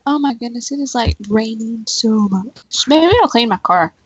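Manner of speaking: normal talking voice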